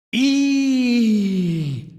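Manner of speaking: long high-pitched "eee"; children's squeal. A narrator's reading. Children reacting to the dead witch, squealing. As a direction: squeal like spooked children, drawn out. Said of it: "said the children".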